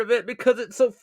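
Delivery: half-crying over a sad episode; choked up. Real, not acted. Vlogger recalling a character's death, choked up and loud. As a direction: voice rising high and loud with grief. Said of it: "for making me cry".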